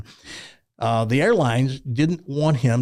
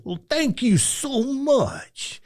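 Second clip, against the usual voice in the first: put-on high, slow, humble old-man thanks; imitating Reggie; 12 semitones higher, much slower than usual. A high, slow grateful old man thanking the host who praised him.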